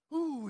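loud, high furious ooh; imitating an angry man's ooh. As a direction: burst out with an outraged ooh. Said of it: "I'm so angry".

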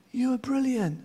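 valley echoes the praise back. Retold or imitated. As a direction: let the echo answer faintly. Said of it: "And the voice came back".

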